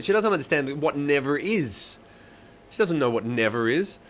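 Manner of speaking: usual speaking voice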